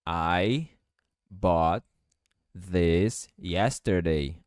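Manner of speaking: each word set apart, slow; "I bought this yesterday" broken up slowly. Shown as a demonstration. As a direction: spell it out word by word. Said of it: "T com T vira T".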